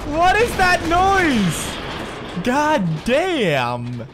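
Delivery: very high, slow drawn-out scared wail; frightened wail. Real, not acted. Frightened by a noise.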